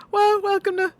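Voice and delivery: put-on Boston accent dissolving into a laugh; mock Boston accent breaking into laughter. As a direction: try the Boston accent again and lose it. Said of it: "New York accent".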